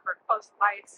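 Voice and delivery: ordinary talk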